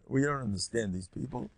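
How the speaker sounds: ordinary talk, nothing marked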